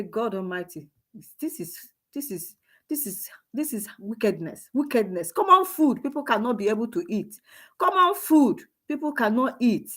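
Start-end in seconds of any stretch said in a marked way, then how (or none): 0.0-5.3 much softer, half to himself; quiet lament. Retold or imitated. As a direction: murmur it in dismay. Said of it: "I say".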